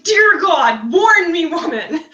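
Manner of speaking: loud, laughing startled cry; startled shout. Retold or imitated. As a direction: shout it, caught by surprise and laughing. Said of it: "and he's like".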